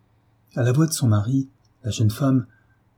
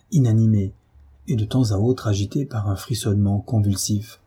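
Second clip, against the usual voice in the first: ordinary delivery, nothing marked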